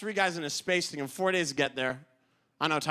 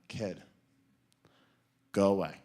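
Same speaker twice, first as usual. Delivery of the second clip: dismissive Armstrong; 9 semitones lower than usual. Retold or imitated. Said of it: "He went".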